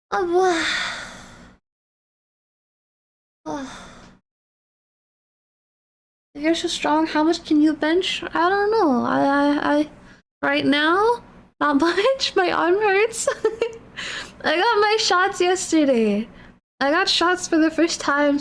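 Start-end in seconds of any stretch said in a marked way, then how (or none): none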